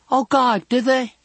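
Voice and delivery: put-on very high-pitched girlish astonishment; amazed girl. A narrator's reading. Girl hearing about soldier's blood, amazed.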